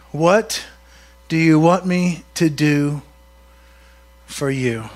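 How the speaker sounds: low, measured question with pauses; calm, unhurried invitation; gentle asking. Acted. Voicing Jesus' gentle question slowly.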